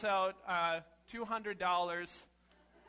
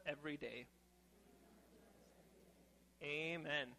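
prayer; 2 semitones lower, 4 dB quieter than usual. Real, not acted. Pastor praying softly with the children after the skit.

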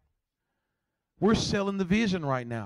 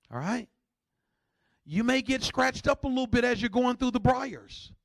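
ordinary delivery

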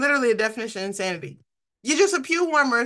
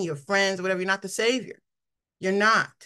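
ordinary talk, nothing marked

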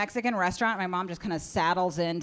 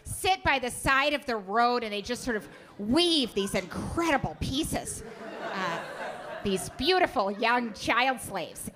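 mom voice; 3 semitones higher than usual. Retold or imitated. Mom voice, clueless tourist rant.

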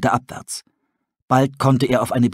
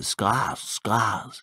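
guttural wolf cries; 8 dB quieter than usual. Acted. Dying wolves begging for their prophet, guttural moan. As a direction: call the name weakly like a dying wolf. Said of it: "ein paar kehlige Schreie".

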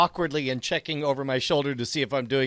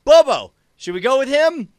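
loud, high call to a buddy; calling to companion; 9 semitones higher, 9 dB louder than usual. Acted. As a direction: call out as if to a pet sidekick. Player calls to his companion in character.

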